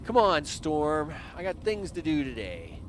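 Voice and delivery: ordinary talk, nothing marked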